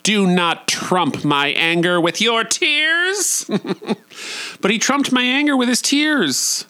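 high, theatrical proclamation; mock-dramatic diva voice; quoted friend. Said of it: "A good friend of mine once said".

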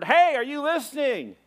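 high-pitched call to someone zoned out; calling for attention. Retold or imitated. Paddle crew snapping him to attention.

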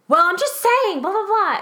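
high, loud defensive retort; imitating a snippy woman brushing it off; mock arguing. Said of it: "She starts arguing".

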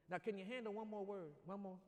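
normal talking voice

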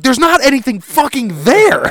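exasperated outburst. Retold or imitated. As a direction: vent at the producers. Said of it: "he's like".

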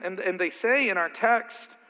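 ordinary delivery

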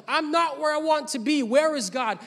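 imitating frustrated believer. Pastor voicing a believer's frustrated inner question, mild complaint. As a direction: ask it like a mildly frustrated believer. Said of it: "you're saying to yourself".

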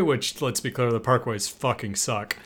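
normal talking voice

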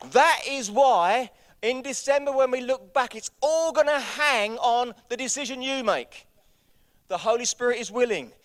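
high, loud, driving sermon voice; rally the congregation at full voice; full-force preaching. Real, not acted.

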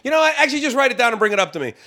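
high, quick dismissive host voice; mimicking the host. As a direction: toss it off fast, mock-breezy. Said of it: "He goes".

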